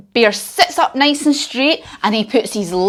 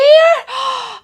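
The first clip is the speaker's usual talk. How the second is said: mock gasp; 14 semitones higher than usual. Empty cupboard shock.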